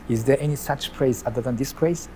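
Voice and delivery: normal talking voice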